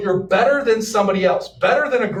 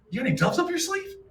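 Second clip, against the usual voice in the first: mock teasing; 9 semitones higher, 5 dB quieter, faster than usual. Teasing the painted missionary.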